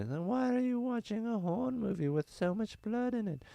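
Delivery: put-on fussy old grandma asking why; grandmother voice. Grandma voice in comedy bit.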